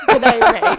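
high, loud mock Australian greeting. Host mocking the Australian cliché, high and loud. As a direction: belt out a hammed-up Australian "Good day, mate". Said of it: "But they don't say".

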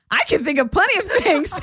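high-pitched imitated comeback; funny retort. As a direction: throw it out high as a comic jab. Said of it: "you responded really funny".

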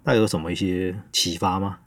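ordinary talk, nothing marked

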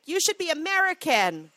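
high, loud sneering mock voice; mocking conservative voice. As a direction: sneer it like an angry patriot. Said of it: "And they're like".

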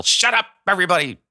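sudden high yell. Retold or imitated. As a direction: snap at the whole room. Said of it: "you just want to yell".

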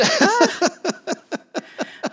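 high, bursting laugh; amused burst of laughter; big laugh. Real, not acted. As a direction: let out a real laugh. Laughing at funny cable story.

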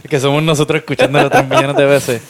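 laughing. Real, not acted. Joke about bot listeners.

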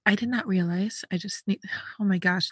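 soft, whispered self-conscious aside; hushed aside. Real, not acted. Podcaster suddenly noticing own speech while telling a story, hushed aside. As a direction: drop to a near whisper.

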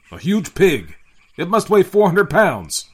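loud, high-pitched outburst; astonished exclamation. A narrator's reading. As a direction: boom in disbelief at the size. Captain exclaims at its size.

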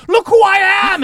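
high loud shriek; scream. Acted. Floating head screaming loud and high. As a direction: shriek it out at the top. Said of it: "she screams".

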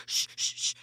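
repeated urgent shushes; retold "shh, shh, shh" shushing. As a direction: imitate someone hushing the room. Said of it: "telling us to be quiet".

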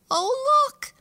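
high, loud alarmed little mouse cry; frightened cry. A narrator's reading. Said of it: "she cried".

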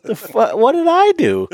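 high, incredulous, near a squeal; baffled outrage. Retold or imitated. Wondering why her friend kept hanging up, shrill disbelief. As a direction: play the wronged friend's disbelief. Retelling being brushed off.

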